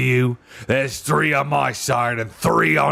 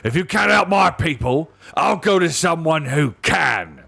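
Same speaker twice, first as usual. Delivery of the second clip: high, raised angry cry; rising to a scream; 8 semitones higher, 4 dB louder than usual. A narrator's reading. Dwarf threatening to go elsewhere for help, near screaming. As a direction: push the voice up toward a scream. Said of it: "voice now rose to a scream".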